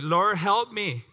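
pleading mother. Acted. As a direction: beg slowly for your daughter's sake. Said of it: "Imagine the woman asking for his daughter".

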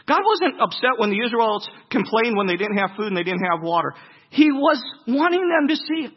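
preacher building to full force; fervent preaching. Acted. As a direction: drive the point home to the congregation.

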